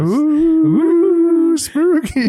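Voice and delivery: high, loud mock-spooky ooh. Friend teasing about spooky shirts, high mock-spooky ooh. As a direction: tease it like a campfire scare.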